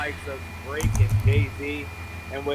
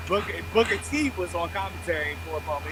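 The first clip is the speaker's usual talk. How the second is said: ordinary delivery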